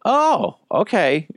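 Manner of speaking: sudden high "Oh" then a meek "okay"; startled oh. Retold or imitated. Acts own baffled reply at customs.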